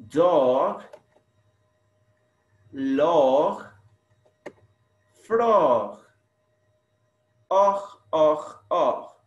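dog, log, frog loud, then the ending "Och" alone; highlighted rhyme. Shown as a demonstration. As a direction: punch each word, then drill "och, och, och". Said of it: "Where's the rhyme?".

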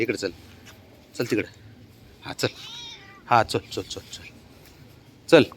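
usual speaking voice

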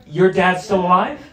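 usual speaking voice

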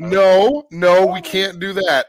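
alarmed loud refusal; loud protest. Real, not acted. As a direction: cut the topic off fast. Panicked refusal to topic.

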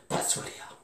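whispered, as if sharing a secret. Retold or imitated. Tax man whispers to himself about where everyone hid. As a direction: breathe it out like a discovered secret.

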